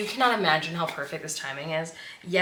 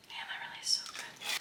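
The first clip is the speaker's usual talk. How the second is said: soft whisper after a sniff; whispered delight at a scent; whispered awe; 13 dB quieter than usual. Real, not acted. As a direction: breathe it out in delight. Sniffing a candle.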